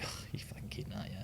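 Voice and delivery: very soft, whispery retold grumble; muttered disbelief. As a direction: grumble it under your breath. Retold grumble at drug tester.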